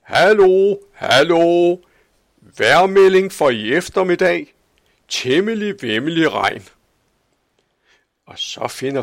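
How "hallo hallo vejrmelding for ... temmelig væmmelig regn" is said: high, loud call over the whole town; old man shouting a forecast from his tower. A narrator's reading. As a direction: announce the weather from the balcony at full voice. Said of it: "råber ud over byen".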